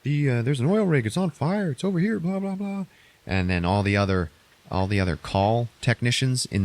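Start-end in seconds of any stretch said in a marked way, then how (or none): 0.0-2.9 higher casual caller voice; lightly voiced caller on the phone. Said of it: "somebody's like".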